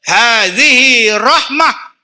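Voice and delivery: slow, weighty Arabic quote; slow solemn quote. Retold or imitated. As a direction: lay each word down with weight. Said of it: "Nabi sebut".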